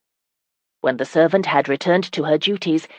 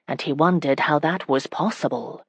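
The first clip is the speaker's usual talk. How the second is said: ordinary delivery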